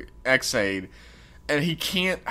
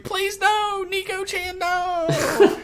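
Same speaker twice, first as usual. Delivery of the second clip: hammed-up begging in a very high voice; Poppy; much slower than usual. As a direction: squeal a mock plea for mercy. Poppy begging while being beaten.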